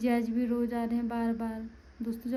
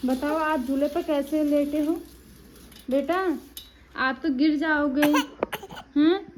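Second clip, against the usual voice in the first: playful mock worry, higher and louder; 3 semitones higher, 7 dB louder than usual. Real, not acted. Mother talks to baby on swing.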